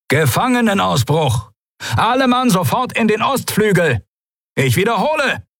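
high urgent tannoy shout; loudspeaker alarm. Acted. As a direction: alarm call over loudspeakers. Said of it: "Stimme … über versteckte Lautsprecher".

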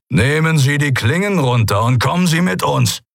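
guard captain ordering the admirals; captain's order. Acted. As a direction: demand surrender flatly.

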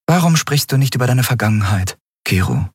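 lightly voiced, probing; Andriel. A narrator's reading. Andriel asking.

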